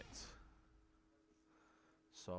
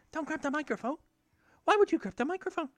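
much higher, louder mock telling-off; squeaky mock scold to the baby; 11 dB louder, faster than usual. Escalating high scold to baby.

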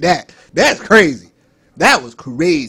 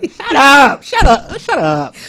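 loud high bossy yell; kid yelling; 9 semitones higher, 7 dB louder, slower than usual. Retold or imitated.

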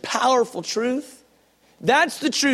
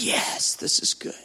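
soft whisper of the soul; the soul whispering its approval; whispered inner voice; 3 dB quieter than usual. Acted. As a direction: breathe it like a quiet inner yes. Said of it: "Your soul goes".

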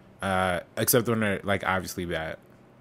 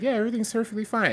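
mock innocent reply; 11 semitones higher, faster than usual. Tyler reassuring his mom, high mock-innocent voice. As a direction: cover up in a high little voice. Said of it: "It's like".

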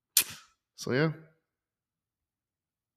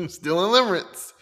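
"limerence" said again through a laugh, higher and louder; laughing repeat; 11 semitones higher, 6 dB louder than usual. Real, not acted. Vlogger laughing at her own limerence confession, repeating "limerence". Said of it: "I'm still in limerence".

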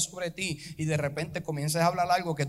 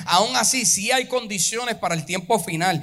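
louder, sung-out preaching over music; full-force preaching; 5 semitones higher, 11 dB louder than usual. Acted. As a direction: build to a fiery climax. (predicación a todo pulmón)

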